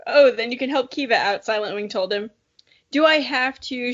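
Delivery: usual speaking voice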